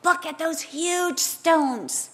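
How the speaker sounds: marvel, drawing the words out; awed exclamation. Acted. Disciples showing Jesus the temple, slow awed exclamation. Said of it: "disciples are saying to Jesus".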